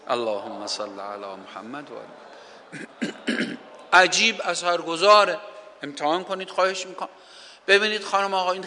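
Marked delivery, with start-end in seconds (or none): 0.1-3.6 slow, soft devotional recitation of the blessing; reciting a prayer. Shown as a demonstration. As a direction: murmur it slowly like a whispered zekr. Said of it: "یک، ذکر". (ذکر آرام و آهسته)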